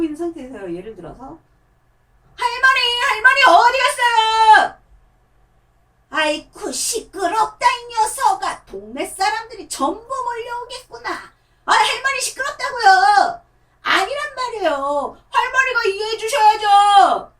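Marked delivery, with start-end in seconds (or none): 2.4-4.8 put-on much higher, louder, slow childish shout; a puppet kid yelling for grandma; child puppet calling. 6.1-11.1 much higher, louder, slow scolding; grandma puppet telling off the kid, loud. Acted. 11.7-17.3 very high, loud, slow whining with a sob; whiny crying child puppet. Acted. Child puppet whines back at grandma.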